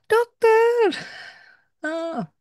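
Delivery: retelling a mother's frantic scream. Retold or imitated. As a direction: shriek for the doctor in terror. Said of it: "Terus ibu itu teriak".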